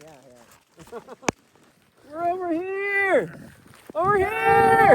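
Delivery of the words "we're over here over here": long high call across the snow. Real, not acted. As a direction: call out to friends far away. Calling out to lost friends.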